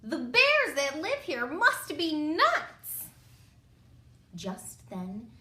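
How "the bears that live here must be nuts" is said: lightly hammed-up, slow complaint; a touch of fed-up little girl; louder, higher storybook grumble; exasperated groan. A narrator's reading. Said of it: "groaned the exhausted girl".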